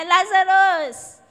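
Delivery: slow, high, loud cry; commanding Lazarus to come out with a loud cry. Acted. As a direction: summon the dead man from the tomb. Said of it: "he cried with a loud voice".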